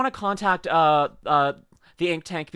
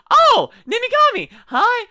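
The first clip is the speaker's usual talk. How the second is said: high, loud delighted call; excited greeting; 10 dB louder than usual. Real, not acted. Streamer greeting a friend in chat, excited squeal. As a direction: burst out loudly on spotting a friend.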